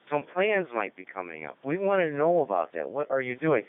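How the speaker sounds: ordinary talk, nothing marked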